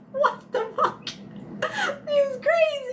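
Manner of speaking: squeaky, very high laughing disbelief. Real, not acted. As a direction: say it while cracking up. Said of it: "I was like".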